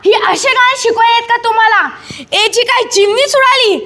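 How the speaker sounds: indignant telling-off; angry scolding. Acted. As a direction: lay into the children. Uncle scolds children for songs.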